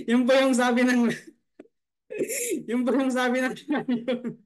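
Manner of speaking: giggling, voice breaking into laughter; can't get through it for laughing; laughing through words. Real, not acted. Storyteller cracking up at punchline.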